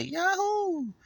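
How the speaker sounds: "Yahoo!" squealed very high, like a cartoon plumber; Mario's jump cry. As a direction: shout "Yahoo!" like Mario jumping in the wing cap. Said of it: "what he say?".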